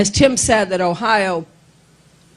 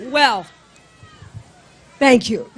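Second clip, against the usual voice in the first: ordinary delivery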